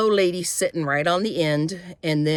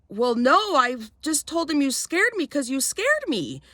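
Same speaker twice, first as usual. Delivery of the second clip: retold flustered defence; 9 semitones higher than usual. Her panicked defence to the attendant.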